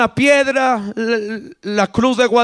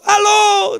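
high, loud phone hello; calling out; 9 semitones higher, 5 dB louder than usual. Acted. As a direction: call insistently down a dead line. Second unanswered phone call.

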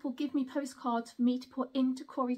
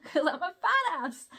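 laughing; 4 semitones higher than usual. Real, not acted. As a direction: giggle at your own cheeky joke. Self-mocking joke.

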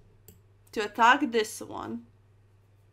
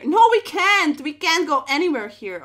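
loud, higher burst of alarm; alarmed outburst; 4 semitones higher, 8 dB louder than usual. Real, not acted. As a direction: blurt it out as you spot the trap. Cornered in the game.

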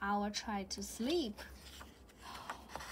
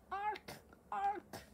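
bird call; 9 semitones higher, 3 dB quieter than usual. Acted. Jay sound in storybook.

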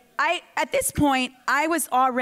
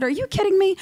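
high, louder disbelieving retort; incredulous; 7 semitones higher, 3 dB louder, faster than usual. Retold or imitated. Telling her sister, incredulous and raised. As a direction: act out her own shock at the idea. Retold disbelief at casting suggestion.

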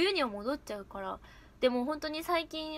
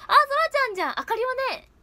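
loud, very high, excited greeting; high-pitched gushing mimicry; あかりちゃん impression; 11 semitones higher, 8 dB louder than usual. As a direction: burst in with a high put-on voice. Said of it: "渡辺あかりちゃん？".

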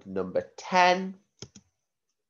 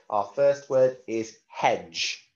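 ordinary talk, nothing marked

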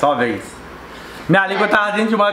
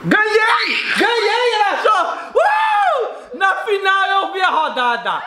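victory shout; 9 semitones higher, much slower than usual. Real, not acted. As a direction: yell it like you just won. Celebrating winning the round.

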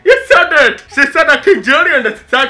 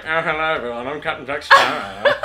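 rich aristocrat voice; 9 semitones lower, 5 dB quieter than usual. Pretend. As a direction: play a lordly pirate, lowered. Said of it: "he sounds like some, like, rich aristocrat".

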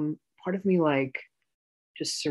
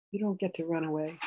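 normal talking voice

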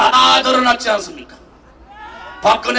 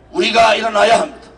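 normal talking voice

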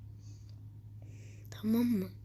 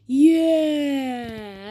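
loud joyful yell; triumphant cheer; 9 dB louder than usual. Real, not acted. Player cheering after wiping out everyone, loud. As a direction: celebrate the win out loud. Celebrates kill.

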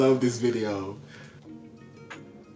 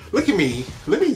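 excited exclamation; 9 semitones higher, 5 dB louder, faster than usual. Real, not acted. Loud self-mocking exclamation.

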